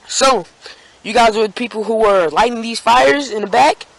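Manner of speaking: mock an officer sizing up suspects; questioning cop. Cop confronts boys about fires, probing question.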